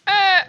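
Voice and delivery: sharp loud yelp; a sudden high scream of surprise; startled cry. Real, not acted.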